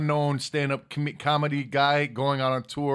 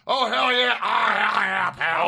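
wrestling promo; 4 semitones higher, 4 dB louder, slower than usual. Acted. Wrestling catchphrase shouted.

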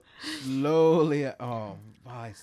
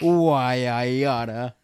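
put-on slow, louder old-lady threat; indignant old lady; 3 semitones lower, 7 dB louder, slower than usual. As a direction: huff it like a cranky old woman. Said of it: "No, she said".

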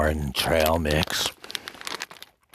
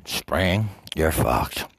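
normal talking voice

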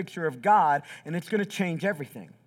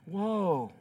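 dread-filled woe; 4 dB quieter than usual. Retold or imitated. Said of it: "here's his first response".

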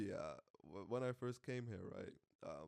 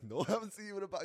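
imitating a friend; 9 semitones higher, 7 dB louder than usual. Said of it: "he's like".

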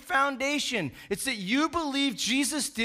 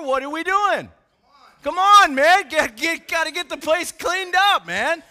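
put-on loud, raised-pitch dad telling the boys off; scolding dad; 2 semitones higher, 6 dB louder than usual. Dad to his messy boys after they disobeyed mother, scolding. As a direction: act out an exasperated father getting on his sons.

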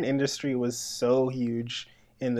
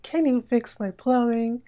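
high-pitched hammy plea for help; corny adult-film line reading; cheesy porn acting; 9 semitones higher than usual. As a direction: put on a high, fake helpless voice. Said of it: "people aren't into the cheesy acting".

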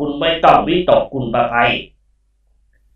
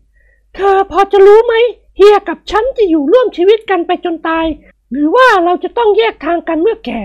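woman's voice. Acted. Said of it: "นวลละออถามสมองกลต่อไป".

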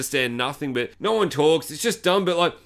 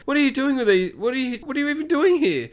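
high, insistent put-on voice; mock exasperated questioning; 11 semitones higher than usual. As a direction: imitate a baffled, annoyed hunter. Hunter questions the woman.

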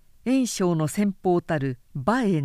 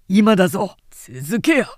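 loud commanding shout to charge; rally the troops, full voice; a general yelling his men into the breach; battle cry; 8 dB louder than usual. Acted. Cao Cao urges troops forward.

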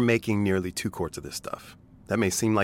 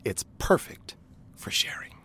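soft secret whisper; 2 semitones higher, 7 dB quieter than usual. Acted. Secretive aside.

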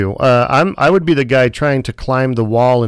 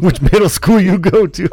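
mocking echo; 5 semitones higher than usual.

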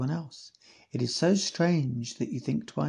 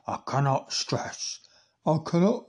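imitating Bob; 2 semitones higher than usual. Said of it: "And this is Bob now".

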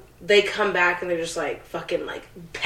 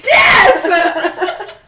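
much higher, louder laughing shout; 12 semitones higher, 8 dB louder than usual. Real, not acted. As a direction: blurt it out through a laugh. Laughing answer to worst case.

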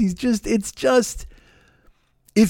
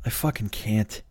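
softer, trailing "I can't"; defeated murmur; 5 semitones lower, 10 dB quieter, slower than usual. Retold or imitated. Hiding from the relative in another room, quiet and defeated. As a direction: fade out each "I can't" in exhaustion. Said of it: "'cause you're like".

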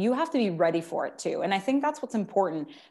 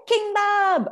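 much higher, louder squeaky voice; minion voice; 13 semitones higher, 7 dB louder than usual. Pretend. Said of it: "Oh, you're good at that".

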